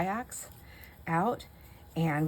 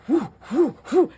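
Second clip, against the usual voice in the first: paddling noises; 10 semitones higher, 8 dB louder than usual. Retold or imitated. Husband paddling toward her.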